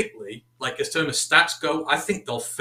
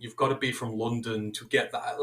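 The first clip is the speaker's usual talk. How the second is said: usual speaking voice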